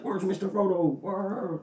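high, drawn-out put-on voice; mock plaintive call, slow and high-pitched; Sam. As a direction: stretch it out in a high character voice. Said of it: "And then Sam's like".

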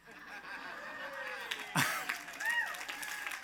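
unvoiced high laugh; high breathy laugh. Real, not acted. Preacher laughing at her own small victory, breathy. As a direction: let out a high airy laugh.

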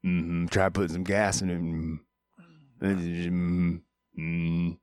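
muffled character grunts; understated quote with grunted mm-hmms; Carl Childers. Acted. Host slips back into the film reference, lightly voiced grunting character. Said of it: "why I was doing the reference".

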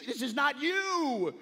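rising urgent plea to dad; pleading. Retold or imitated.

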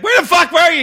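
high, loud imitation of an irate demand; angry yelling. Comic abandoned on stage berating the host, yelling. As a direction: yell it as the furious colleague would. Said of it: "He's yelling at me".

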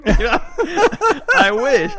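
loud high laughing. Real, not acted. Laughing at own memory.

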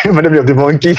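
laughing through the words. Real, not acted. Teasing a friend about the movie monkey, laughing. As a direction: tease your friend, breaking up with laughter.

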